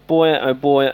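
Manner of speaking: normal talking voice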